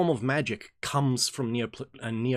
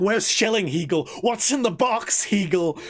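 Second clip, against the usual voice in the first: loud, desperate shouting; mock movie-scene yelling; frantic yell; 6 semitones higher, 5 dB louder than usual. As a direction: scream it like Brad Pitt in Seven. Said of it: "I'm like".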